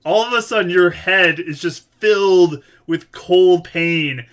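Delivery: loud, forceful game master voice; dramatic narration. A narrator's reading. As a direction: hit the players with the bad news. Game master announces sudden painful hit.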